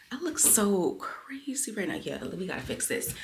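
breathy whisper aside. Real, not acted. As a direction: whisper it to the camera.

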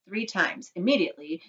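plain reading voice

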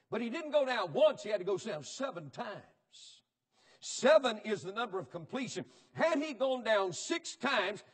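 loud, high-pitched pulpit preaching; fiery revival sermon delivery; full-force preaching. Acted. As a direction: preach it hard to the congregation.

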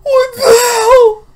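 high, slow shriek mixed with laughter; shocked half-laugh, half-scream. Real, not acted. Jump scare, laughing in shock.

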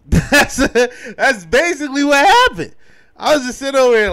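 loud, high, laughing through it; cracking up at the story. Real, not acted. Vlogger laughing to viewers about the retort, loud and high.

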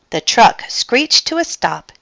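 ordinary delivery, nothing marked

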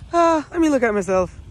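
loud, high show-off reply; acted-out swagger; cocky protest. Cocky acted answer to evaluator.

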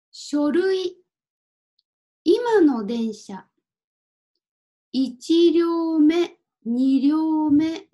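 careful, clearly separated Japanese words; deliberate language-teacher enunciation with pauses; pronunciation drill. Shown as a demonstration. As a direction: model each word for learners to repeat. Models Japanese words for learners to repeat.

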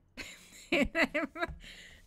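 high, loud burst of laughter; real laughing fit at yourself. Real, not acted. Reader finding she has no water, helpless laughter.

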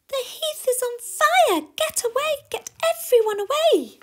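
frantic high shouting; Danny. Acted. Warbler warns everyone of fire, high panicked shouting. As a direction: shriek the warning at full volume. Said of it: "cried Danny".

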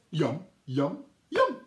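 yum rising high at the end; chirpy little bird enjoying food. Acted. Teacher voicing the first bird eating its crumb. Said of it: "the next little bird says".

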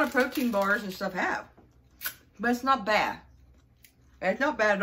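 chewing between words; talking while chewing. Real, not acted. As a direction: mouth partly full. Seen 2: Lips pursed, chewing motions, fingers touching mouth while talking about bar.

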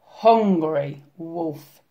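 ordinary delivery, nothing marked

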